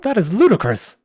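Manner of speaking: high, loud indignant scoff. Acted. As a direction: sneer at the price, appalled. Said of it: "the man scoffed".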